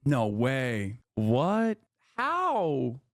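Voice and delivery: hammed-up fake shock, sarcastic; mock surprise. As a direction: act stunned, dripping with sarcasm.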